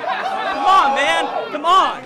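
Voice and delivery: high, loud exasperated call; real loud protest. Real, not acted. Rapper calls to the noisy crowd mid-round, shouting. Calling out over crowd noise.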